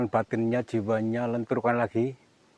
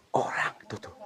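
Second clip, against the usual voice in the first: hushed unvoiced whisper, pointing something out; 4 dB quieter than usual. Real, not acted. Ghost hunter whispering to the camera about a figure. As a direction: breathe it so nothing hears.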